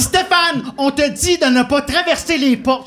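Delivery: high loud call down the hall; nurse yelling. Retold or imitated. Head nurse yelling after the escaping patient, loud. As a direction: shout after the runaway patient. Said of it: "Elle part à courir. Elle dit".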